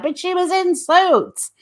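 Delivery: high-pitched mocking voice of gushing fans; exaggerated high protest, mimicking naive admirers; mock Americans. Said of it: "but Americans are like".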